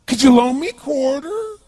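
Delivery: high, slow, loud odd voice of a beggar; bizarre drunk voice. Retold or imitated. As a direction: plead slowly like a drunk man. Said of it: "He had this really bizarre looking sounding voice".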